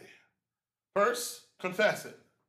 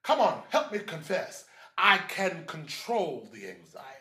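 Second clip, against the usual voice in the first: ordinary talk, nothing marked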